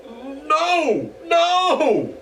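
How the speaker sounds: high, loud goblin shriek; goblin scream. Acted. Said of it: "the goblin screamed".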